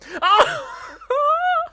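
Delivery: very high squealing laugh-cry; high pained laugh; shrieking frustrated laugh. Real, not acted. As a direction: let out a strangled shriek. Frustrated outburst after failing.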